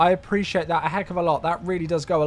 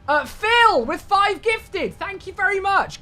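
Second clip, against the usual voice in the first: much higher, loud, thrilled thank-you; excited thanks; 13 semitones higher, 4 dB louder, slower than usual. Real, not acted. Streamer thanking Phil for five gifted subs, high excited thanks. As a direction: gush your thanks with real delight.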